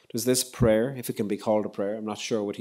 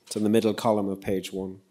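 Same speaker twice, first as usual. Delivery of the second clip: normal talking voice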